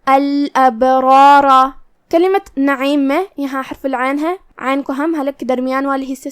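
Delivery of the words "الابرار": slow chanted word with full heavy R; الابرار chanted slowly with heavy, full R sounds; heavy recitation. Shown as a demonstration. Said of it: "تفخیم سے ادا کریں گے".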